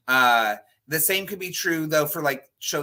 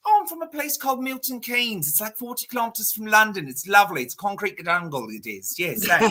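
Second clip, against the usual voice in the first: put-on higher, chatty British accent; 6 semitones higher than usual. Server telling diners where he's from in a British accent. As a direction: put on a cheerful Milton Keynes accent. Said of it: "British days".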